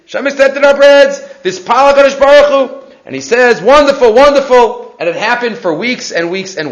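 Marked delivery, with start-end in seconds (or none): 0.0-2.8 high, loud acted excitement; imitated overjoyed husband; all excited. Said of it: "he was all excited". 3.6-4.9 higher, louder acted delight; imitated happy exclaiming; excited delight. Excited celebration of the news.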